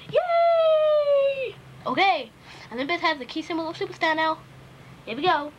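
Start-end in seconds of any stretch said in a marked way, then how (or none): 0.1-1.5 high, loud victory cheer; cheering yay. Pretend. Crowd cheers for Olympus winning, high loud yay.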